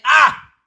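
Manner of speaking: a high, loud groaning "ah"; demonstrating an empathetic groan; pained sigh. Shown as a demonstration. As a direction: sigh out loud like you share the hurt. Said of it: "he has to say".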